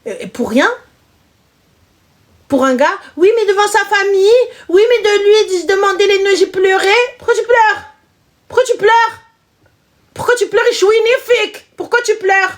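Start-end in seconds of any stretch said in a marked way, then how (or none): 2.5-7.0 much higher, mocking a crybaby; mock whiny voice. Mocking a crying girlfriend. 7.2-7.8 high taunting crybaby voice; mock her high and whiny. Mocking crybaby, repeated. 8.5-9.1 high taunting crybaby voice; mock her high and whiny. Mocking crybaby, repeated. 10.2-12.5 much higher, mocking a crybaby; mock whiny voice. Mocking a crying girlfriend.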